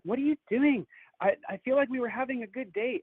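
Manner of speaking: high-pitched mimicked complaint; complaining date. Date dragged behind a bush, mock baffled complaint.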